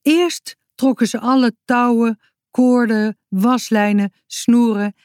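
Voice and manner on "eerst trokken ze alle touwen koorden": plain reading voice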